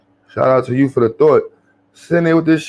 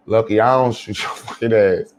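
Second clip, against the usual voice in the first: usual speaking voice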